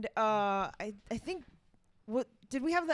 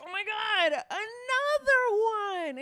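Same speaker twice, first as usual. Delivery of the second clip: higher, drawn-out fake amazement; playfully overdone surprise; mock surprise; 8 semitones higher, 5 dB louder, slower than usual. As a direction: act astonished at the extra gift. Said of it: "so I'm like".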